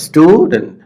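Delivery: Stew high and strong in student; stress on first syllable. Shown as a demonstration. Teacher leading learners to repeat, strong first syllable. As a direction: punch stew, then drop to dent. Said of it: "একটু জোরে বলবা".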